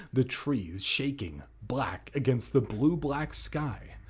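ordinary talk, nothing marked

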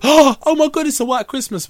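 high, loud thrilled cry; excited. Retold or imitated. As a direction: squeal it like a kid seeing snow. Recalls seeing snow as a kid.

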